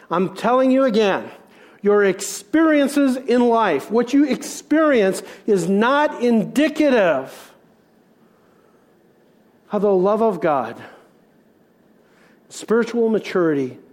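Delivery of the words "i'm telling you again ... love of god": preaching at full force. Acted. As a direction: preach it out to the whole church. Preacher building to climax.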